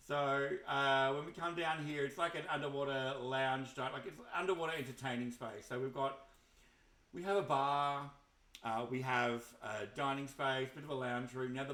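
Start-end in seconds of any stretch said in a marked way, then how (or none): none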